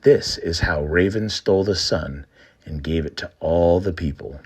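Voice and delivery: plain reading voice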